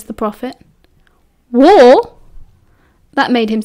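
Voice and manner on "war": loud, higher shocked question; sharp one-word exclamation of surprise; startled. A narrator's reading. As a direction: burst out in disbelief. Startled reaction to shocking news.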